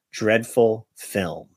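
usual speaking voice

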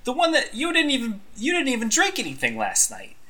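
high, sputtering complaint; indignant bartender. Acted. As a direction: pitch up to whine about the unfair treatment. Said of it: "and he says".

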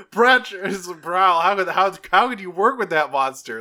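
mock outrage. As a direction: play scandalized, hammed up.

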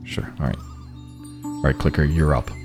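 ordinary talk, nothing marked

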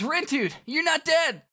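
high, loud shout of surprise; excited Fret. Acted. Fret to a friend found alive, excited. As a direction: exclaim it at a high pitch.

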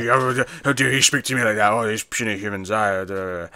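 a hammed-up indignant alien queen in a Muttley growl; mock outraged royal, cartoon growl; Muttley-style huffy queen. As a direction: play the offended queen with a cartoon-dog snarl. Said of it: "A reasonable impression".

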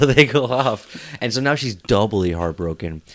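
ordinary delivery

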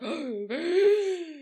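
a sharp, high gasp from the court; shocked gasp. Retold or imitated. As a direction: gasp like a scandalised crowd. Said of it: "And the court's like".

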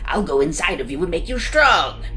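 a coaxing monster, lightly voiced; sly monster making promises. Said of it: "promised the monster".

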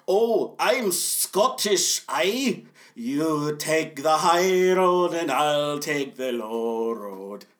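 slow, hammed-up Scottish brogue; mock an actor's bad, drawn-out Scottish accent; terrible Scottish accent. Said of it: "terrible Scottish accent".

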